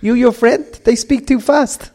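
high-pitched mock Spanish accent. Colleague Marcial complaining about the podcast, in a high mock Spanish accent. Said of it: "I won't do it with the accent".

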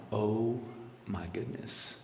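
ordinary talk

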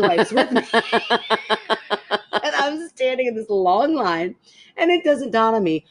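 high, giggly storytelling through laughter; can barely get it out for laughing; laughing while telling. Real, not acted. Telling her friend the gas-station story, laughing throughout.

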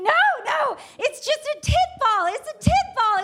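very high, hammed-up shriek; panicked screaming. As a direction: freak out, pleading at the top of your range. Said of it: "I'm freaking out, and I start screaming".

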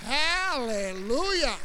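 slow, loud, exultant hallelujah; drawn-out hallelujah. Real, not acted. Preacher rejoicing after the gang obeyed, slow hallelujah. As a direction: stretch it out in triumph. Celebrating victory.

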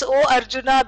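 normal talking voice

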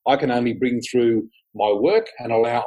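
ordinary talk

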